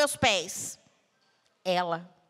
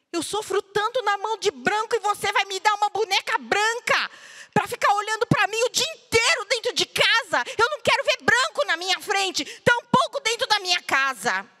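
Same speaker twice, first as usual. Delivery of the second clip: much higher and louder, furious; angry outburst; 9 semitones higher, 6 dB louder than usual. Retold or imitated. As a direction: shout it at her like a hurt child. Girl shouts at mother about doll.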